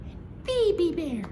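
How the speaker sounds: high squeaky little bear; tiny Baby Bear voice. A narrator's reading. Narrator naming the littlest bear in his tiny voice. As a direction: say it like the littlest cub.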